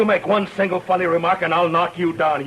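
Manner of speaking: stern threat. Acted. Marshal threatens Doc.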